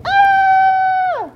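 high piercing shriek; lady's shriek. Acted. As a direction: scream out in shock. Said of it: "hears a lady shrieking".

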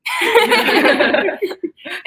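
bursts out laughing; big real laugh; loud laugh. Real, not acted. Asked about coach staring.

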